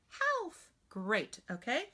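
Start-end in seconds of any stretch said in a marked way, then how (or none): 0.1-0.7 put-on high, childlike reply; teacher voicing a small pupil's high answer; child's voice. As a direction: answer like a young student. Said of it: "You say it".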